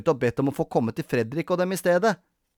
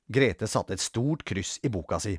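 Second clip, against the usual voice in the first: plain reading voice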